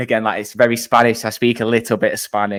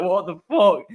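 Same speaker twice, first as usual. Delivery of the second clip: mock disbelief; 9 semitones higher than usual. Locals react to their accent.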